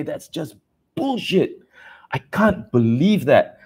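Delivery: slow, high, disgusted disbelief; indignant. Real, not acted. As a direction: drag it out in disgust. Rant about overpriced parts.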